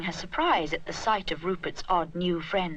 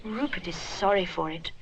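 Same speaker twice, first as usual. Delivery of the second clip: ordinary delivery, nothing marked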